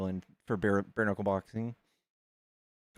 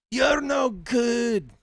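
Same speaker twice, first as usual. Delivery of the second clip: slow, high, shouted taunt; 12 semitones higher, 8 dB louder, much slower than usual. Retold or imitated. Feuding traveler taunts his rival on a videotape, slow high shout. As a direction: bellow the insult slowly, much higher. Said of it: "They'd be like".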